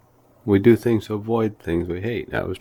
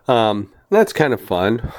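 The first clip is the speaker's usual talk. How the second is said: ordinary talk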